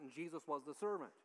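ordinary talk

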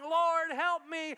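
high, loud and drawn out; a desperate woman calls for help; slow shouted plea. Acted. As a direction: cry out to him, begging.

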